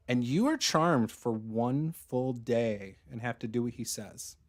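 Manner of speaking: normal talking voice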